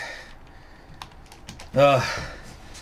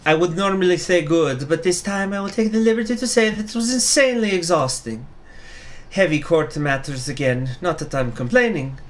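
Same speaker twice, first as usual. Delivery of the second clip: higher tired judge voice; 6 semitones higher than usual. Acted. Judge answers in character.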